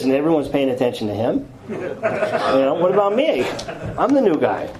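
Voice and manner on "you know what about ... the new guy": mildly wounded, higher-pitched protest; hammed-up poor-me plea; indignant self-pity. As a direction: play up the jealous complaint. Jealous newcomer voices his complaint.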